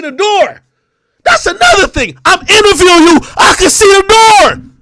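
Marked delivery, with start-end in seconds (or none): 1.2-3.2 louder and higher, building outrage; shouting. Real, not acted. Mocking her small apartment, a heated shout. Heated rant roasting a rapper. 3.4-4.7 slower, louder and higher, hammering it home; loud repeat. Real, not acted. Mocking her small apartment, a loud slow repeat.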